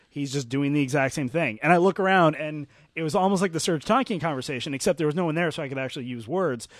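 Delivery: ordinary delivery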